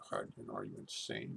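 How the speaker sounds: muttered disbelief. Retold or imitated. Muttering to himself at the doctor.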